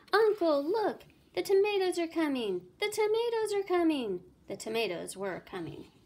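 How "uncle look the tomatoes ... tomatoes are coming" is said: high, loud panicked scream. A narrator's reading. Sally warning her uncle, high loud scream. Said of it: "Sally screamed".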